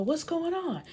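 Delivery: high-pitched imitation of someone bursting in; acted-out startled question; mock alarm. Retold men running in alarmed.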